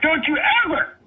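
father screaming at a man who threatened his family, furious; furious shouting. Real, not acted. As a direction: scream the threat back at him, losing control. Caller rages at threat to family.

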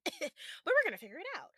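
laughing it off. Real, not acted. To new podcast listeners, admitting she's unsure, laughing. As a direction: laugh away the nerves as you say it. Nervous about starting a podcast.

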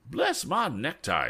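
high, slow astonished exclamation; fussy high-pitched surprise; exclaiming. A narrator's reading. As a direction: draw out the oath in amazement. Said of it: "exclaimed Mister Damon".

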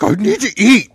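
loud, high mock outburst; pissy, breaking. Imitating starving Julian losing it, pissy mock outburst. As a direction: crack and yell it, imitating a pissy man. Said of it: "he's very pissy. He's, like, breaking".

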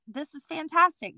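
high-pitched upbeat self-quote; mildly gushing; enthusiastic. Retold or imitated. Voicing her own past enthusiasm.

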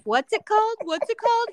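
high, giggly imitation of teasing; mock hyena cackling. Retelling Ramel teasing her, mock cackling voice. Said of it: "started cackling like a hyena".

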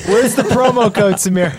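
laughing, high teasing question. Real, not acted. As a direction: tease through laughter. Joking about the promo code.

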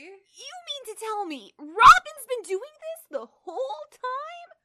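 put-on high, slow, whiny teen boy voice; obnoxious teen boy. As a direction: play a bratty teenage boy. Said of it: "make them obnoxious teen boys".

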